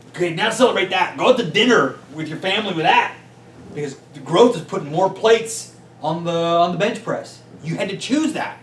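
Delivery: loud, high, pumped-up push; rally them to celebrate the hard choice; fired-up urging. Real, not acted. Speaker urging business owners to celebrate growth, fired up and loud.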